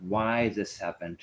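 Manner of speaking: why hit hard with a falling tune; stress on WHY. Shown as a demonstration. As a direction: punch the word why, let this happened drop. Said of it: "Not what happened".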